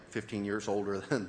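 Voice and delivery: normal talking voice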